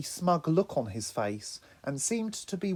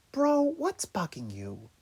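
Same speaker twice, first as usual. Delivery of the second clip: slow, concerned and gentle; caring; 6 semitones higher, slower than usual. Acted. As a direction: ask gently, with real concern. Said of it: "in a genuinely caring tone".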